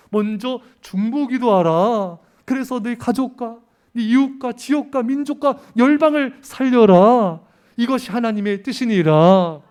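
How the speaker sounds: rising to a proclaiming climax, each word hammered; preaching at full force. Acted. Pastor exhorts the church to pray for the nations, full-force preaching.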